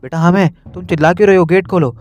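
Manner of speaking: a mom calling her son from outside the door; mother calling through door. Acted. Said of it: "door के पीछे से आवाज़ आती है". (दरवाज़े के पीछे से माँ की ऊँची आवाज़)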